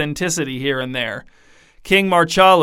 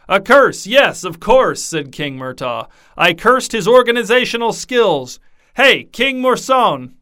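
higher, louder merry king voice; king boasting to his guests, loud and gleeful; jovial king; 6 semitones higher, 4 dB louder than usual. A narrator's reading. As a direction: relish the reveal, drawing out the end. King calls to sleeping king.

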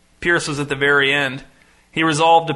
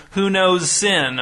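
slow, drawn-out, higher-pitched pulpit question; preacher's repeated question; 4 semitones higher, much slower than usual. A narrator's reading. Pastor repeating his question to the congregation, slow and preachy. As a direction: stretch it out like a preacher. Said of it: "repeating the question".

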